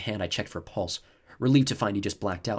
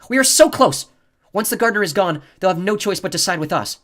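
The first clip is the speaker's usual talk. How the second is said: much higher and louder, agitated; 10 semitones higher, 11 dB louder than usual. A narrator's reading. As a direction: push it out, agitated and loud. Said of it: "She was agitated. Her voice was louder".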